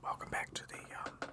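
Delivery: breathy ASMR whisper. Acted. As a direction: whisper softly like an ASMR stream. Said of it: "A S M R stream".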